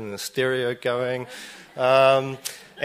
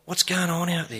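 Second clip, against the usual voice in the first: higher, quick puzzled call out the window; 7 semitones higher than usual. Retold or imitated. Wondering aloud what the neighbor is up to, puzzled. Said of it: "to go".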